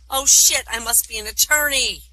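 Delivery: loud, high punchline outburst; dismayed realization. Acted. Snake realizing what it is, loud high dismay. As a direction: blurt the awful discovery high and loud.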